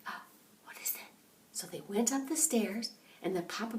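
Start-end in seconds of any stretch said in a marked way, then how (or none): 0.1-1.1 scared whisper. Acted. Bears hear snoring.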